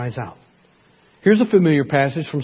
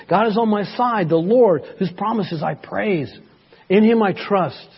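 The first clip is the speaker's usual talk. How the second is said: fervent psalm reading; 3 semitones higher than usual. Acted. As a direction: cry the psalm out as prayer. Dramatic scripture reading.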